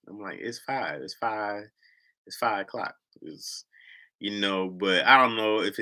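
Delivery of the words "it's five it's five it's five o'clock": "five" with a flat ah vowel, final v dropped; Midwest five. Shown as a demonstration. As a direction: clip "five" to "fah". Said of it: "making fun of me for saying five".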